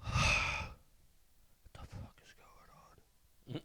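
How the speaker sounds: slow, breath-only disbelief; exasperated whisper. Retold or imitated. Housemate retreating to the front room, hands on face, despairing whisper.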